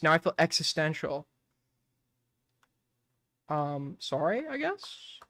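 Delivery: ordinary talk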